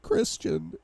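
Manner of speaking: very high, tearful call; sobbing. A narrator's reading. Wife sobs to husband on the phone, tearful. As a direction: choke out the name in a high sob. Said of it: "she sobs".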